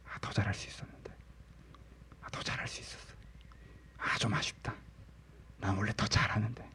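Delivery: hushed, sighing self-reproach; regretful self-talk. Acted. Preacher voicing inner self-talk, hushed and regretful. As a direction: mutter it to yourself with mild regret. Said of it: "자기한테 그렇게 계속 얘기하잖아요".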